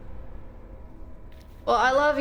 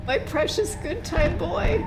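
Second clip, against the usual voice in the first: slow tender lament; 7 semitones higher, slower than usual. Real, not acted. Grieving the dog.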